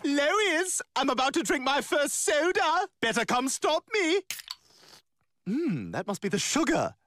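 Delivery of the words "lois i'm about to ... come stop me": teasing sing-song call; calling out to Lois. Acted. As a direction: bait her into coming over. Calling out to get attention.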